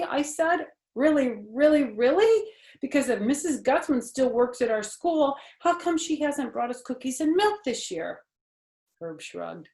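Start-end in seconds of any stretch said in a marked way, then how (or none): 0.9-8.2 high, loud, amazed; can't believe it, pitched up; excited disbelief. Acted. Junie B. astonished.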